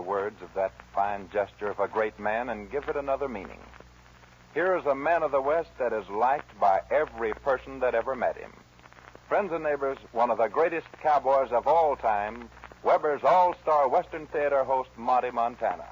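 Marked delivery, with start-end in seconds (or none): 4.5-15.9 booming showman welcome; announcer intro. Acted. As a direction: present the star to the crowd.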